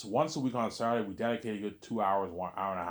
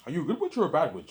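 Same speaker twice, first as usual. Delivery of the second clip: quick, high, louder imitation; 8 semitones higher, 6 dB louder, faster than usual. Vlogger quoting an actress's witch line, high quick imitation. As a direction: mimic her question in a raised, hurried voice. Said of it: "remember she's like".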